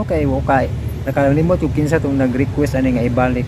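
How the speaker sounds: ordinary talk, nothing marked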